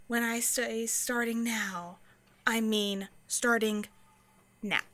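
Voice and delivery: slow, measured words with pauses; deliberate clarification. Acted. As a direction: spell it out slowly, pause before the last word. Spells out the rule deliberately.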